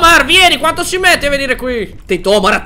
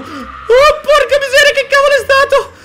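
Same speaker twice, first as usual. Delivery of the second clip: startled laughing cry; 12 semitones higher than usual. Real, not acted. Gamer startled by a noise, laughing. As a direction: burst out laughing in fright.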